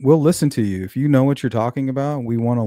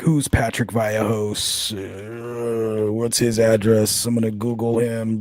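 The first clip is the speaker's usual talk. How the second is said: slow put-on troll voice; mocking troll voice; slower than usual. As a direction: mimic a sneaky troll plotting. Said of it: "they're like".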